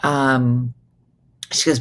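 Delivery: ordinary talk, nothing marked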